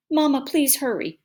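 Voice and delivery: urgent pleading. A narrator's reading. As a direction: beg Mama to hurry. Child pleading with mother.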